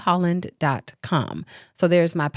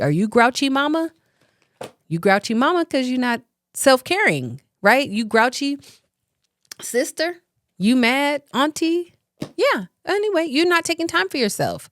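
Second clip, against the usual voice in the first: high, teasing call-out; playful teasing; 7 semitones higher than usual. Real, not acted. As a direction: poke fun at grouchy moms.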